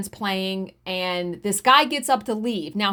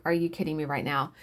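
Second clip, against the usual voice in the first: muttered disbelief; 8 semitones lower, 7 dB quieter, faster than usual. Retold or imitated. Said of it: "I was just like".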